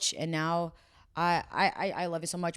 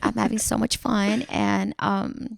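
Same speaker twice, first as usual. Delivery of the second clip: put-on bubbly Valley girl YouTuber voice, pitched up; Valley girl accent; 3 semitones higher than usual. Showing friends her Valley accent vlogger voice. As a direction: ham up a ditzy Valley girl vlog intro. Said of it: "speak in English like that".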